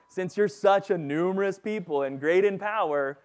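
sarcastic. Retold or imitated. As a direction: throw it back at them with sarcasm. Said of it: "you should hear a bit of sarcasm in Joshua's voice".

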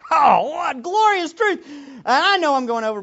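loud, high joyful exclamation; triumphant preacher cry; exultant. Real, not acted. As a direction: burst out in praise. Exultant outburst.

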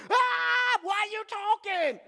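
high, hammed-up shriek of shock; high-pitched freaked-out outburst; mock panicked scream. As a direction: scream in pretend terror at a talking animal. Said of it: "you don't go".

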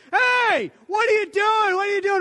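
high, loud furious yelling at a spouse; angry shout. Acted. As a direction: yell it out like someone caught doing wrong. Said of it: "we just throw fits of anger".

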